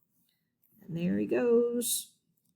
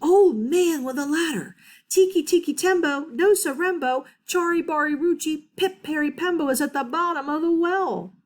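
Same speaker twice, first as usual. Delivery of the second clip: last-breath shout; 7 semitones higher than usual. Acted. Chang shouting to the old man with his last breath. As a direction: throw the last of your breath at him. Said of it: "he shouted".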